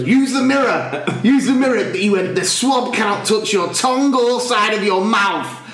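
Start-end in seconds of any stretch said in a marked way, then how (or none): 0.0-1.8 high, insistent imitation of a helper; put-on intensity, pitched right up; mock loud order. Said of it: "you could hear him everywhere". 2.3-5.6 high-pitched, emphatic imitated warning; mock booming instruction, pitched up; mock loud instructions. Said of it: "you could hear him everywhere".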